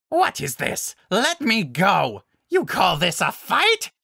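loud, high, outraged monster cry; furious beast shouting in protest; screamed. Acted. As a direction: scream in outrage, stretching the demand. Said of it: "the alpha screamed".

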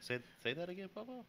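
high-pitched, puzzled asking; puzzled request. Acted. Grandson asking grandfather to repeat, high puzzled voice. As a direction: ask it high, straining to understand.

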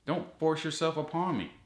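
ordinary talk

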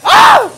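very high sudden shriek of fright; real scream. Real, not acted. Startled visitor shrieks at a monster. Jump scare in haunted house.

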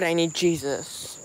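soft, slow groaning plea; exasperated despair. Real, not acted. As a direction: sink into the words, worn out. Upset streamer despairs aloud.

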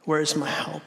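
soft, low plea; quietly asking, pitch dropped; searching question. Acted. Preacher voicing those in uncertainty, soft low plea. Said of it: "In moments of uncertainty".

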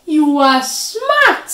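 high, loud and drawn-out admiration; impressed storybook spider, loud and high; delighted praise. A narrator's reading. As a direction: exclaim it slowly, full of admiration.

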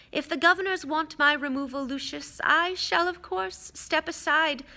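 put-on high, measured old-mentor voice; composed, softening on asides; dignified headmaster, calm. Headmaster's reply in narration.

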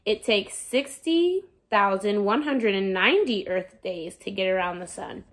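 lively picture-book read-aloud voice; reading to children. Acted. Teacher reading a sun picture book to her students, lively storytelling. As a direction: read it like story time for a class.

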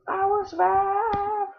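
high, very slow, stretched-out cheer; long, high wail of relief; drawn-out celebration. Real, not acted. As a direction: stretch the words out high in triumph. Celebrates escaping danger.

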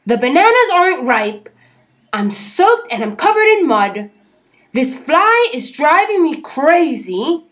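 loud, high building rant; exasperated rant. A narrator's reading. Fed-up Jim rants at everyone urging him to celebrate. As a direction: let it boil over into a wail. Jim's angry outburst.

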